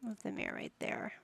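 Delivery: whispered to herself. Real, not acted. Building the nursery, whispering to herself. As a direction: breathe it under your breath.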